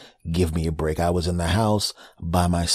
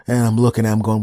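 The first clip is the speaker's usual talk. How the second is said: usual speaking voice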